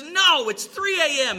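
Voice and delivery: high, indignant refusal; angry. Acted. Husband woken at night refuses drunk stranger, angry. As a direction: snap back angrily, pitched well up. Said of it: "And he angry".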